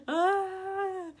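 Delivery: high drawn-out mock sob; imitated high wail of weeping; mock bawling wail. Mom retells crying over the book, mock wail.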